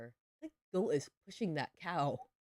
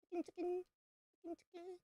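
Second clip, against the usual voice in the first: much higher, sing-song chicken call; 13 semitones higher, faster than usual. Pretend. Gamer calls her chickens in the game, high and playful. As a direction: coo it up high at the hens.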